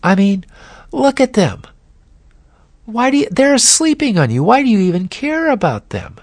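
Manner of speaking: high, quick, wheedling tempter voice; a sly devil whispering doubts, pitched high; Satan tempting Jesus. Retold or imitated. Satan tempting Jesus in the garden, high and wheedling. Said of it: "Can't you almost hear Satan saying".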